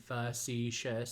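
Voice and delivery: facetious slowly broken into three syllables. Shown as a demonstration. Teacher demonstrating the finger-under-chin trick, facetious syllable by syllable. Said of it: "pronounce the word".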